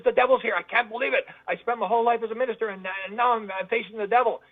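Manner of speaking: high, agitated mock dread; retold panic. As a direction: imitate a terrified preacher confessing disbelief. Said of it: "the guy's just freaking out".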